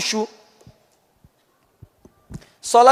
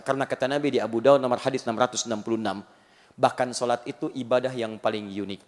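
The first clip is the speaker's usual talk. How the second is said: usual speaking voice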